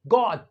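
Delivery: गॉट with a rounded British o; गॉट in British English, lips rounded on the o; British got. Shown as a demonstration. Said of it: "British English में गॉट".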